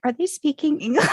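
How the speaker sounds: high-pitched mock confusion; imitating bewildered mom. Daughter quotes mom lost in British TV, high bewildered imitation. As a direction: voice a baffled mom, pitched well up.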